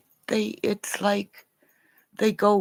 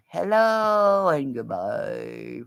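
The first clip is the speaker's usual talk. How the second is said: slow low sign-off; 12 semitones lower, 7 dB quieter, slower than usual. Pretend.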